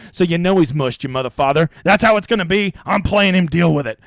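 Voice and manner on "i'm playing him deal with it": ordinary talk, nothing marked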